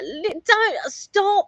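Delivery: mock scolding. Mock scolding partner quote.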